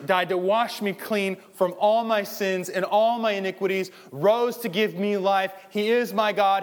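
loud, driving pulpit delivery; preaching at full force. Acted. Preacher rousing the church at full force. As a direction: hammer each line home to the congregation.

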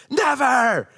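put-on high, loud defiant kid's never; defiant child. Son refuses his dad, high and defiant. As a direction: play the stubborn four-year-old refusing. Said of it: "he looked at me and went".

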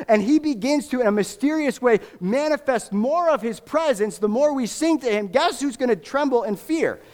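high-pitched, rising sermon climax; impassioned preaching. Real, not acted. Preacher urging the church to sing to the living King, impassioned high preaching. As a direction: preach it with fervor, pitch lifted well up.

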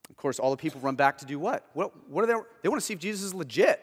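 usual speaking voice

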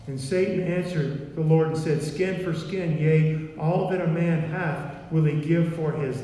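loud, declaiming King James reading; scripture reading. Acted. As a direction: proclaim the verses to the congregation. Reads scripture aloud, loud.

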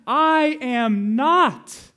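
"I am not" loud, high and very slow; drawn-out, ringing "I ... am ... not"; climactic emphasis. Real, not acted. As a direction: proclaim "I am not", stretching every word. Climax of the sermon's point.

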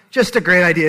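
exaggerated sarcastic echo; loud sarcasm. Shown as a demonstration. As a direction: punch the irony on "great". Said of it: "which is just a great idea".

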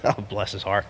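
low, soft, chuckled aside; quiet, knowing half-chuckle; amused chuckle. Acted. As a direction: murmur it with indulgent amusement. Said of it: "he had to chuckle".

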